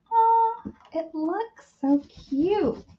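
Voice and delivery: high, drawn-out coo; an aww-so-cute squeal, slowed down; cooing delight. Real, not acted. Cooing over the finished piece.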